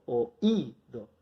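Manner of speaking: "oído" lento, con la fuerza en la "í"; fuerza sobre la I. Shown as a demonstration. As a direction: separa la "o" y carga el acento en la "í" de "oído". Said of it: "toda la fuerza cuando hablo la pongo sobre la I". (di "oído" despacio, apoyándote en la "í")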